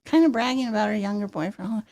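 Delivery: usual speaking voice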